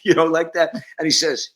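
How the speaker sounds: usual speaking voice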